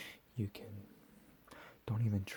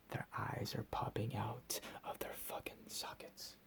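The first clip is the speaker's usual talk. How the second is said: ordinary delivery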